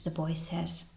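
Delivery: plain reading voice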